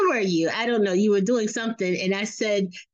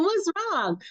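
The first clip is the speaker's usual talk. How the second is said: alarmed question; 9 semitones higher, 3 dB quieter than usual. Retold or imitated. Mother reacting to yelling upstairs.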